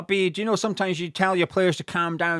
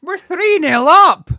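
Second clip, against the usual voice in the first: drawn-out, higher, much louder roar; elated shout; 9 semitones higher, 12 dB louder, slower than usual. Real, not acted. Fan shouting the scoreline after the replay, triumphant shout.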